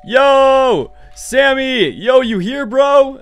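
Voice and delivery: calling out. Real, not acted. Calling friend at the door.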